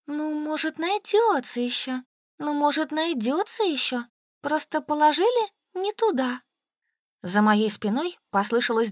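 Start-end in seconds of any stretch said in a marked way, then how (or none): 0.1-6.4 high, plaintive wail in words; tearful lament. A narrator's reading. Woman keeps hoping the lost thing turns up, tearful lament. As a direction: lament it in a raised, plaintive pitch. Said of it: "безудержно рыдала, приговаривая".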